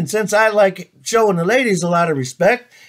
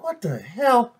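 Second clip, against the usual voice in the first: frustrated; 4 semitones higher, 5 dB quieter, slower than usual. Acted. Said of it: "now he's frustrated".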